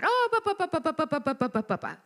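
rapid "ba-ba-ba" babble starting high and falling; mock chatter. Mimicking the enemy's endless talk to her, mock chatter. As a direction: rattle off nonsense syllables, pitch sliding down.

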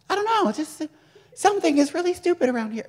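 very high, fast, mocking put-on voice; silly teasing voice. Older sibling taunting a younger one, squeaky mock voice.

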